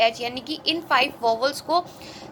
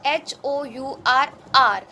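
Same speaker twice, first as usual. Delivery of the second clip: slow spelling; 4 dB louder, much slower than usual. Shown as a demonstration. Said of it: "जब हम इस word को pronounce कर रहे हैं".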